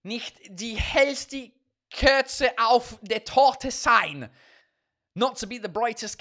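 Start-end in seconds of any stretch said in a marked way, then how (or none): 0.0-4.3 slow, deliberate German reading; loud, laboured German pronunciation; attempted German. Shown as a demonstration. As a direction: sound it out in German, word by word. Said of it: "here's a German one".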